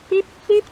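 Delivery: high, loud piping peep like a tiny frog; frog peeping call. Retold or imitated. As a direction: chirp it like a spring peeper. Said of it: "You ever heard spring peepers?".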